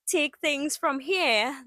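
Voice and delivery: ordinary talk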